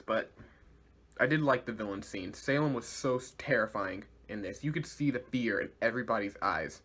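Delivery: usual speaking voice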